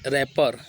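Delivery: "रैपर" said ra-por, no final t; silent t. Shown as a demonstration. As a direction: stop "रैपर" on the r. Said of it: "लेकिन टी silent रहेगा".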